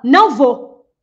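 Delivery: loud, emphatic refusal; hard, loud no; firm refusal. Shown as a demonstration. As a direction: say a firm no with full force. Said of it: "A sua entonação diz tudo".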